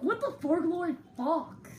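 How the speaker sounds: a light mock-dopey retake of the question; mocking imitation of Taylor. Insisting Taylor never sounded like this, light mock imitation. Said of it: "You have never sounded like that".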